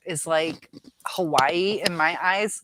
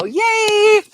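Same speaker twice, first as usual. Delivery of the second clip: excited cheer; 13 dB louder than usual. Real, not acted. Celebrates guessing correctly.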